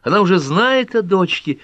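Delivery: high, loud burst of joy; excited exclamation. A narrator's reading. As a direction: exclaim it delightedly, pitch soaring. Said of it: "воскликнула Пэт".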